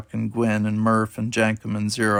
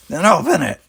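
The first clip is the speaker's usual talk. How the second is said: higher, louder curt command; snapped order; 8 semitones higher, 7 dB louder than usual. A narrator's reading. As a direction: snap the order impatiently. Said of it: "she snapped".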